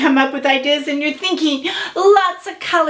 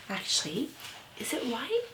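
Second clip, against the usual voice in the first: ordinary delivery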